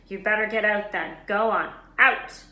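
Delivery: shooing out. A narrator's reading. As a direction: drive him out loudly. Librarian throws Harry out.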